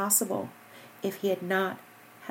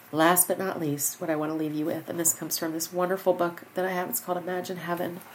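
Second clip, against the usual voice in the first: ordinary talk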